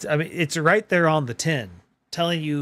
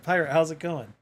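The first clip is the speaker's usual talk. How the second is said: ordinary delivery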